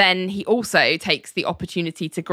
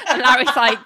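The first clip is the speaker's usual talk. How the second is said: usual speaking voice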